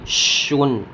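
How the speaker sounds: شن starting with a long sh; careful Arabic sheen in شن; sound of sheen. Shown as a demonstration. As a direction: spread a full sh into شن. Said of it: "شین کی آواز آئے گی".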